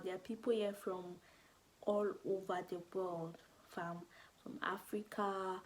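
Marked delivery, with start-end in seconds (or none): none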